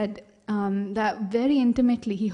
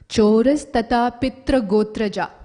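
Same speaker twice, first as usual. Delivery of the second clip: loud, declaimed Sanskrit comeback; emphatic retort; 7 dB louder than usual. Acted. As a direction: declaim it back forcefully.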